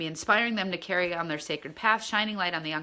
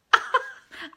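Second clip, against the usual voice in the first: laughing; 5 dB louder than usual. Real, not acted. Laughs at her own slip.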